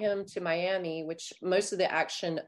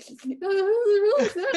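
high, loud mock-weepy wail; hammed-up blubbering through a laugh; mock crying; 13 semitones higher, 8 dB louder than usual. Author imitating herself rereading her own tearjerker, mock crying.

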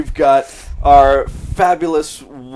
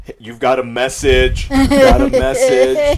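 phone call voice; 10 semitones higher than usual. Retold or imitated. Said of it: "give people a call of just like".

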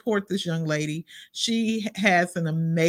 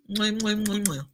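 between kisses. Real, not acted. Blowing kisses goodbye.

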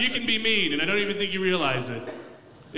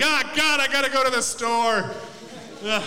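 imagined roommate's lament; 5 semitones higher than usual. Retold or imitated. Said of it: "It's like".

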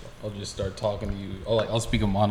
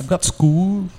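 mock Cockney; 10 semitones higher, 12 dB louder, much slower than usual. Mimicking the Cockney demo.

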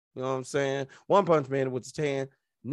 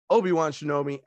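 ordinary talk

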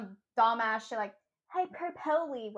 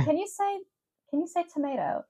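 higher, eager, can you say tomato?; asking the British woman; 9 semitones higher than usual. Retold or imitated. Co-host imitating himself asking the British woman, eager. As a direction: coax her to say tomato. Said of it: "British woman earlier".

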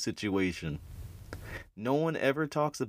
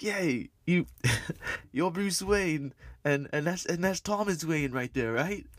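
put-on New York mugger accent; 7 semitones higher than usual. Mugger in the alley greeting the Waynes, in a high New York accent. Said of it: "a New Yorkin accent".

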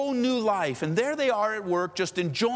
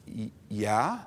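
lower, stammered reply; hesitant convert; 9 semitones lower than usual. Acted. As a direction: falter on the answer. Shaken convert hesitantly answers challenge.

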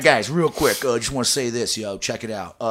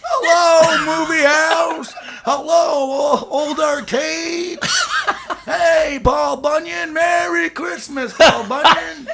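joyful shouting movie hero; 10 dB louder, much slower than usual. Pretend. Joyous Christmas movie hero parody.

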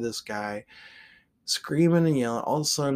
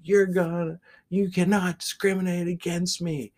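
angry protest; 8 semitones higher than usual. Retold or imitated. Man yelling at driver again.

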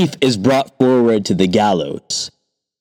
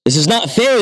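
protesting cry; 10 semitones higher than usual. A narrator's reading. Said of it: "the thief cries out".

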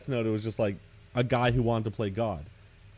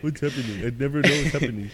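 higher, louder, faster mock of a lost angel; hammed-up clueless angel; 3 semitones higher, 4 dB louder, faster than usual. Mocking the Angel of Knowledge to friends, hammed-up and rushed. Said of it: "Angel of Knowledge is like".

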